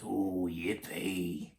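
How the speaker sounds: low, soft, very slow yippee; understated growly glee, drawn out; crying out. A narrator's reading. Tyrannosaurs spot the fallen tree, low slow glee. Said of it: "the T's cried out".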